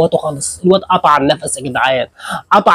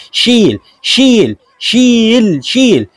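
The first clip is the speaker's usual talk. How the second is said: drawn-out cheer; 5 semitones higher, much slower than usual. Real, not acted. Cheering fighter to lift.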